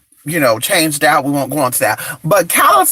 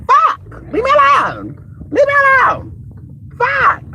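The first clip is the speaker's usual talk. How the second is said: high squealing frustrated cry; high-pitched frustrated yell of fuck and leave me alone; exasperated squeal. Real, not acted. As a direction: shriek it out, fed up. Fed up with callers, squealing.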